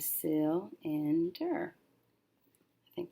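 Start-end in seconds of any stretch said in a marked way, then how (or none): 0.0-1.7 cylinder said slowly as one blended word; careful slow blend of cylinder. Shown as a demonstration. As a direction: draw out cylinder, blending its syllables. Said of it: "So if I put it together".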